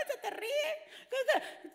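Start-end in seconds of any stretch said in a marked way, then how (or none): none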